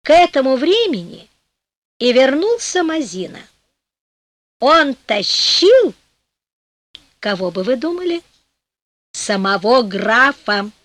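ordinary delivery, nothing marked

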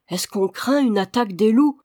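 plain reading voice

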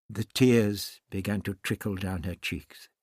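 ordinary delivery

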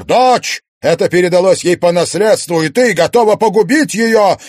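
very loud, high, impassioned shouting; ringing, reproachful outburst; outraged protest. A narrator's reading. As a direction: accuse her with a father's desperation. Father pleads with possessed wife, shouting.

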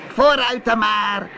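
high-pitched witch call; calling sea witch. Acted. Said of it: "riep de zeeheks". (roepende zeeheks, hoge stem)

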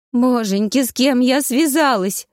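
high-pitched mock-tragic wail; wailing lament. A narrator's reading. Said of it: "запричитала Таня".

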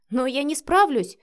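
protesting. A narrator's reading. Wife told to take over, protesting. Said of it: "запротестовала Вика".

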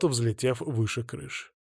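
ordinary delivery

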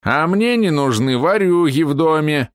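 put-on slow, high grumpy old man; a cranky old man warning off a thief. Old man guarding his house.

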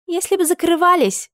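high indignant retort; flaring up. A narrator's reading. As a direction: snap back sharply. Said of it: "взвилась Вайлет".

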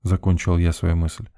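plain reading voice

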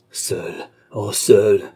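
slow, drawn-out cry of rage; clenched rage. A narrator's reading. Young woman finding nobody to help her, slow clenched rage. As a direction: stretch each word, swelling on the last. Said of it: "avec une crispation de rage".

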